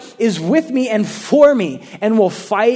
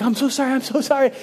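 high-pitched mock pleading apology; 7 semitones higher, much faster than usual. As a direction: beg for mercy in a raised voice. Surrendering to man with bat.

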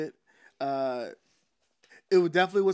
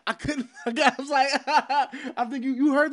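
higher-pitched, laughing as he talks; talking through laughter; 7 semitones higher than usual. Real, not acted. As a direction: crack up while telling on yourself. Laughing about his sore knees.

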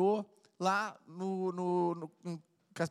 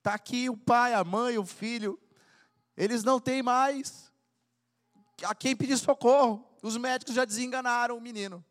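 high, loud cry to God for help; fervent prayer crying out for the family; pleading prayer; 6 semitones higher, 4 dB louder than usual. Acted. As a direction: beg the Lord with raised voice. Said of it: "a hora que você diz assim".

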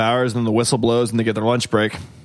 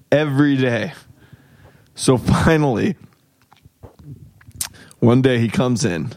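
ordinary talk

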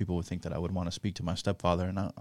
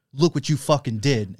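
louder and higher, building resolve; present, rallying; 5 semitones higher, 8 dB louder than usual. Retold or imitated. The cohost voicing the second ghost, rousing and loud. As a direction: lift the room with it. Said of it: "And then it's like".

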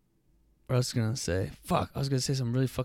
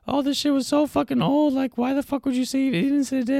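much higher mocking whiny voice; 4 dB louder than usual. Mocking a viewer who complained about dates, high whiny voice. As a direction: whine it like a nagging critic. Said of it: "they're like".

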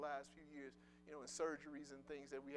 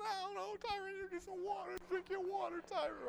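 much higher mock mother's voice; imitating mom. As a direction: squeak it out like a fussing mama. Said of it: "She said".

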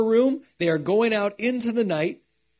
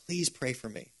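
soft plea; 4 semitones lower, 10 dB quieter than usual. Retold or imitated. Jesus in mourning asks friends.

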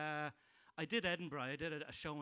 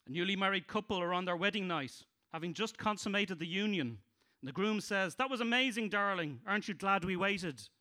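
loud, higher setup voice; stand-up joke telling; 4 semitones higher, 7 dB louder than usual. Acted. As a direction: sell it to the crowd.

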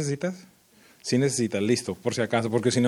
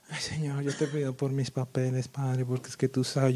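soft, timid little prayer; mock murmured prayer; 8 dB quieter than usual. Imitating our prayers to God, barely voiced. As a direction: keep it small and under the voice. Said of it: "no susurraron. Y a veces nosotros, nuestra oración es".

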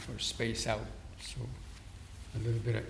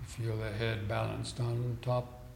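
guided meditation; 4 dB quieter than usual. Real, not acted. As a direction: talk them into stillness, unhurried and steady. Leads seated breathing meditation.

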